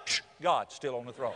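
low, soft shush and reassurance; calming hush. Acted. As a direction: hush the worry, then settle it low and soft. Hushing the worrier.

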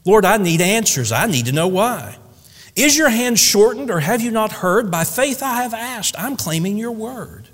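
high, urgent plea to the Lord; impassioned prayer, pitch climbing; pleading cry. Acted. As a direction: demand answers from God in desperation. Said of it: "longingly I cried".

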